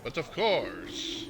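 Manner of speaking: slow Southern drawl, lightly done; a courtly Southern gentleman, taking his time. Acted. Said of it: "I gave him a southern voice".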